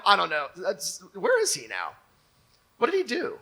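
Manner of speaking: usual speaking voice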